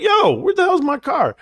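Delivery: hammed-up cry of a man finding his car gone; mock outrage. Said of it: "I'll be like".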